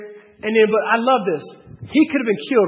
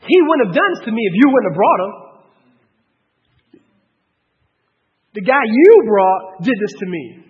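loud, accusing outburst; acted wife's loud accusation; 6 dB louder than usual. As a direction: throw the blame at him, loud. Wife acts out blame shift.

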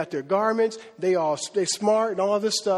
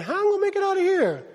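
high, quick fretting; mock worry; 10 semitones higher, faster than usual. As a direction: voice a worried man's thought, pitched up and hurried. Voicing Paul's worried thought.

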